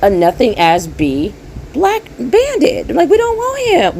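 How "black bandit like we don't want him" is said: mocking dismissal.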